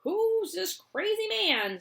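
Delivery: put-on high slow shrieking old woman; outraged shriek; screamed. Grandma Josephine screams at Wonka, outraged. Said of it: "screamed Grandma Josephine".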